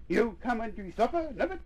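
high, light motherly call. A narrator's reading. Mother calling her child to supper. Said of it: "called Missus Nubbet".

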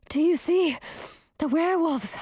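high, breathless question in broken phrases; gasping for air. A narrator's reading. Girl winded from running asks companion, gasping and high. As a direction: gasp it out between breaths. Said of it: "gasping for air".

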